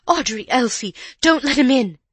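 high, loud, panicked shout; panicked cry. A narrator's reading. Housekeeper as if her own door was threatened, panicked cry.

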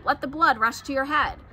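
ordinary delivery, nothing marked

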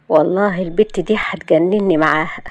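ordinary delivery, nothing marked

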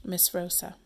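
ordinary talk, nothing marked

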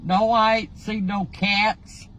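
ordinary delivery

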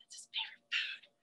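hushed, voiceless storyteller's whisper; very soft confiding whisper; whispered aside. A narrator's reading. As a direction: whisper it like a secret to the kids.

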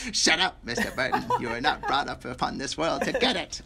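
imitated villain snapping a put-down; hammed-up movie villain scolding, close to laughing; Lo Pan. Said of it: "I love Lo Pan's character".

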